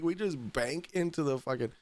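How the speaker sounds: ordinary talk, nothing marked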